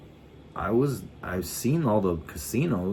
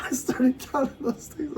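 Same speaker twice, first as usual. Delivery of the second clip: squeaky, much higher, still laughing; high laughing repeat. Retold or imitated. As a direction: repeat it high through the giggles.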